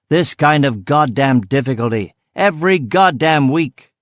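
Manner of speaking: loud, emphatic exasperation, rising in pitch; angry rant. Acted. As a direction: hammer out the complaint, slowing on the last words. Boss rants about constant difficulties.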